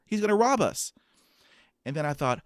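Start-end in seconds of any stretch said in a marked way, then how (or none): 0.1-0.9 high, frantic imitation of the teller; a mock-alarmed bank teller voice, pitched well up; panicked teller. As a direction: mimic the teller panicking, much higher. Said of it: "I can hear the teller".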